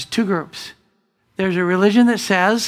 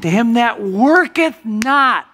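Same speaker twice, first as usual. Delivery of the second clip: slow, louder on worketh; scripture emphasis; 4 semitones higher, 6 dB louder, slower than usual. Acted. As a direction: read worketh out slowly and loud. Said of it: "the word of God is saying".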